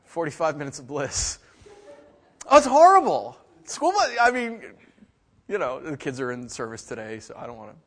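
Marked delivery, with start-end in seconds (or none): 2.5-4.6 exclaiming. Real, not acted. Drops sarcasm, exclaims truth.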